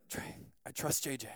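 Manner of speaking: low, hushed reply as the friend; soft imitation of a trusting friend; quiet trust. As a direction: murmur it as a simple, settled answer. Retold friend's quiet answer.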